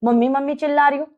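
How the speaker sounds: ordinary talk, nothing marked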